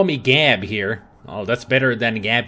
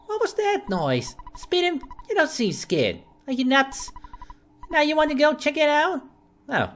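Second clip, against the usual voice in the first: high-voiced mom with a vague New York accent; worried mom, lightly put-on New York accent; mom's odd accent; 9 semitones higher than usual. Mom scolding her son at night, vague New York accent. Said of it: "that accent that I just gave her".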